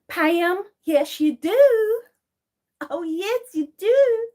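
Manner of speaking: high, loud sing-out with stretched endings; teasing insistence. Acted. Streamer insisting everybody has an accent, high teasing insistence. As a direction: crow it in drawn-out contradiction.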